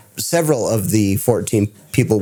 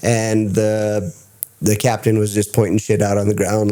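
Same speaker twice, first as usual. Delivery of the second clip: ordinary talk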